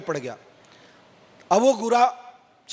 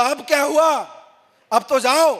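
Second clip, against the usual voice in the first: exasperated; 5 semitones higher, 4 dB louder than usual. Retold or imitated. Exasperated staff to crying man.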